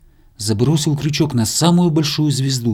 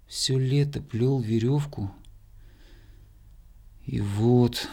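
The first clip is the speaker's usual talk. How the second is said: slow, soft rueful musing; sorrowful; 4 dB quieter, slower than usual. A narrator's reading. Hare hanging in the sky, slow sorrowful thought. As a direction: think it aloud sadly and slowly. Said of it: "горестно думал заяц".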